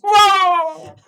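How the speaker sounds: high, loud whooping "whoa"; long, loud high-pitched holler; shouted whoa. Acted. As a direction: cry out as if racing past at speed. Acting the rush past warships.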